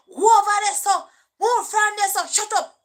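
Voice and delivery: loud scolding mother; mother yelling. Retold or imitated. Mother ordering kids out of the yard, loud yell. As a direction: bark it at the kids like a fed-up mother.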